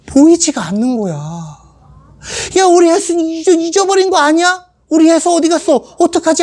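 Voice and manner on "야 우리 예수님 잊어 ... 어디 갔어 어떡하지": high, alarmed rush; panicked parent. Acted. Parent panics after losing the boy, alarmed. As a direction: fear rising, lost child.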